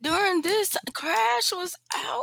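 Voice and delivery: very high, disbelieving question; incredulous. Retold or imitated. Realizing Crash was out during this show, incredulous. Retold surprise at the game.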